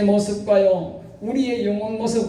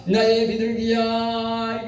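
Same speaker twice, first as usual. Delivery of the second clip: tender call; much slower than usual. Shown as a demonstration. Preacher voicing tender love call.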